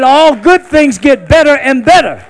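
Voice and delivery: loud preached refrain; loud repetition. Shown as a demonstration. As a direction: shout it to the crowd. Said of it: "I said".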